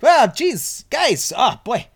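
high, loud put-on goofy voice; Jesse impression. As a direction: push it up high for a hammed-up character impression. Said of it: "sounds like my Jesse impression".

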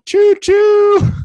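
high, loud choo-choo; train whistle. Pretend. Comedian likening vaccines to trains, loud whistle imitation. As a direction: hoot like a train entering a tunnel.

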